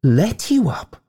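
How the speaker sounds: high-pitched, wondering echo; marvelling. A narrator's reading. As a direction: echo it back in high amazement. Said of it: "the ghost marvelled".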